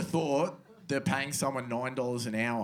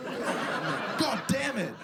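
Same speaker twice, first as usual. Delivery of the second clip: slow, high, drawn-out curse with a laugh in it; lightly voiced old worker groaning a curse; weary old labourer; 9 semitones higher, much slower than usual. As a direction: drag the swear out like a worn-out old tradie. Old digger cursing walking away.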